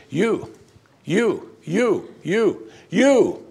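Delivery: each YOU pitched high, set apart; emphatic YOU. Retold or imitated. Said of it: "He means it this way".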